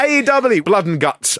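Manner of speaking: normal talking voice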